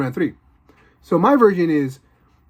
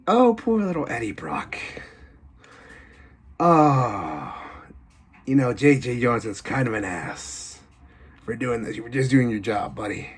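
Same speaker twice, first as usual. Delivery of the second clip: low, softer taunting psycho voice; mocking killer; 5 semitones lower, 5 dB quieter than usual. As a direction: sneer at the reporter's bad luck. Killer mocks Eddie in his journal.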